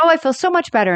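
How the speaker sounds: high-pitched acted relief; delighted relief. Retelling Rose's reaction to the phone call, high relieved gushing. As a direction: imitate someone suddenly cheered up.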